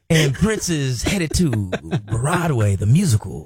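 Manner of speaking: deep, slow smooth radio voice; sexy voice. Pretend. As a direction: draw it out low and silky. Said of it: "my new voice".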